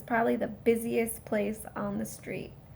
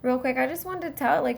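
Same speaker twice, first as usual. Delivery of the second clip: ordinary delivery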